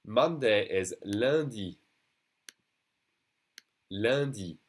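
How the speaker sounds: lundi with nasal un, said slowly; French lundi. Shown as a demonstration. Teacher shows how lundi is pronounced. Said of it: "that U N is pronounced lundi".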